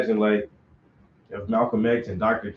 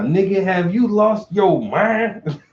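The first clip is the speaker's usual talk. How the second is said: slow, loud, higher scolding as Malcolm X; 5 semitones higher, 5 dB louder, slower than usual. Retold or imitated. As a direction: drag it out like a shocked elder scolding. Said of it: "I can see you, Malcolm X".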